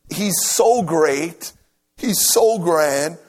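ordinary talk

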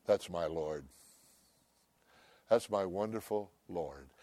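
normal talking voice

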